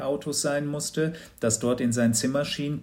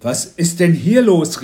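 loud, higher-pitched father's demand; called out; 5 semitones higher, 10 dB louder than usual. A narrator's reading. Father to intruders in son's room, loud call. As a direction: demand an explanation loudly. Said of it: "rief Papa".

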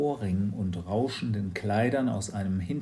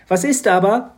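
put-on high, loud girl's voice; a bright, bossy little girl; Mathilda's voice; 10 semitones higher, 11 dB louder than usual. Mathilda speaks. (hohe, laute Mädchenstimme)

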